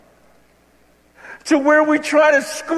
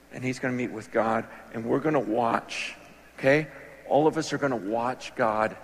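much softer, lower hushed telling; 11 semitones lower, 9 dB quieter than usual. Pretend. Pastor picturing God visiting the church, hushed. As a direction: draw them in, almost confiding. Imagining the scene for the congregation.

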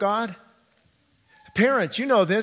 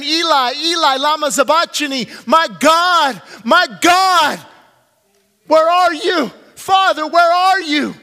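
loud, high cry of the forsaken; anguished cry to God; 8 semitones higher, 9 dB louder than usual. Acted. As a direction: shout it up to an absent Father. Voices Christ crying out on cross.

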